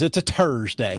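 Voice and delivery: "Thursday" as "Turs-day" in a put-on Irish accent; mock Irish accent. Co-host teases about the date, mock Irish accent. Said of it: "alienated all of our Irish listeners".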